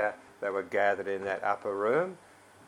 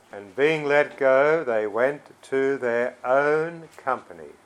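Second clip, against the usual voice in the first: loud, higher reading with "own company" punched; stress on key words; 4 semitones higher, 7 dB louder, slower than usual. Shown as a demonstration. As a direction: lean hard on "own company". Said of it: "Again, I'm emphasising the key words".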